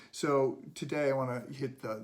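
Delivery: ordinary talk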